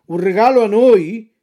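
loud mock-offended fox; indignant cry. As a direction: bristle as if insulted. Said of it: "gridò la volpe sdegnandosi".

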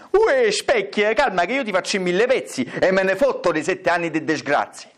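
hammed-up bossy stepmother; threatening stepmother. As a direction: rattle off a comic threat in dialect. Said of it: "E la matrigna".

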